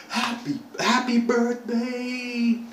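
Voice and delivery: high, drawn-out shout; imitated surprise-party cheer. Party guests to the birthday captive, imitated cheering. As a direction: cheer like a crowd jumping out, acted.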